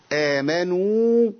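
آمنوا with the final واو held long and slow; stretched madd. Shown as a demonstration. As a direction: draw out the و in آمنوا for its full length. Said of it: "هات لنا مثال".

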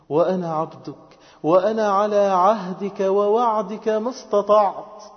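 slow, high, rising in loudness; fervent recitation. Shown as a demonstration. Worshipper pledging himself to God's covenant, fervent slow recitation. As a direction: build the supplication with measured fervour.

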